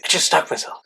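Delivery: under the breath, mildly alarmed; a whispered admission of trouble; hushed, whispered confession. Retold or imitated. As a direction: whisper it to a friend. Confiding to a friend.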